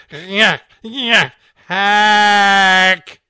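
loud hacking cough sound; cat hacking hairball. Acted. Cat coughing up a hairball, loud hack.

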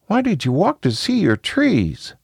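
put-on high-pitched little kid question; high, inquisitive child voice; curious child. A narrator's reading. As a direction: ask like a curious young child.

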